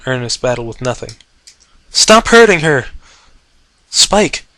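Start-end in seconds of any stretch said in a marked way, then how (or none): 1.9-2.9 furious shout. Acted. Dragon yelling at attacker. 3.9-4.4 high loud call. Acted. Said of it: "she called again".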